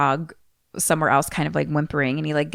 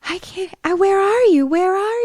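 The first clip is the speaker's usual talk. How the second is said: put-on lost little girl, echoey; 14 semitones higher, 3 dB louder than usual. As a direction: sound far away and lost. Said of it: "her voice comes with, like, a, it's like reverb almost".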